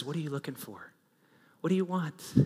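gently posed, hushed question; soft question. Acted. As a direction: ask it quietly, leaving room to answer. Jesus' question, asked softly first.

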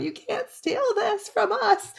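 high, drawn-out fan's wail, held back in volume; slow, squeaky-high pretend shouting; mock yell. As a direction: replay a stadium protest softly and high. Re-enacting her yelling at the match.

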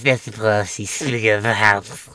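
mock slurred, sleepy Pelosi; a hint of a drugged, drifting speaker; Nancy Pelosi now. Mocking Pelosi as she sounds now, slurred. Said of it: "kinda quickly, too... on something".

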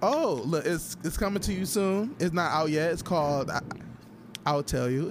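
normal talking voice

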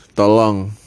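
ordinary talk, nothing marked

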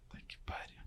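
almost silent whispered swearing; hushed, disbelieving aside; stunned whisper. Retold or imitated. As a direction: whisper the curse under your breath. Reacting secretly to the paper.

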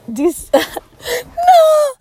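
high laughing shout of no; laughing cry. Real, not acted. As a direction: squeal through a laugh. Necklace breaking.

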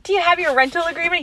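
high, fast imitation of a clerk; mock-polite customer service voice; sassy clerk. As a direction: rattle off the question with chirpy sass. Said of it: "her sass back is peak comedy".

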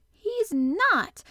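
high, loud, almost shouted denial; fierce indignation. A narrator's reading. Mary defending Colin up the wall, fiercely indignant. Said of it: "almost shouting up the wall in her fierce indignation".

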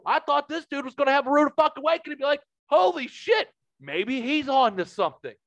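high, loud, fast and worked up; excited disbelief. Real, not acted. Venting to the co-host about the friend, excited and high. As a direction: pile on the outrage. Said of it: "indignant retelling".

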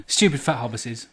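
lightly done Gollum. Retold or imitated. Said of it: "That, that".

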